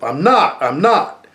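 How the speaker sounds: put-on a boy fiercely denying he lies; fierce denial. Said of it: "He had said it fiercely".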